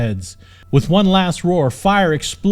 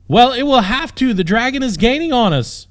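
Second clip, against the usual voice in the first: much higher, louder alarmed cry; panicked shout; 9 semitones higher, 5 dB louder than usual. Acted. Princess warning the knight, high loud panicked cry. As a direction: alarm as the dragon gains.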